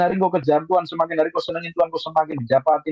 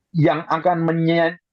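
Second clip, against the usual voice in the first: normal talking voice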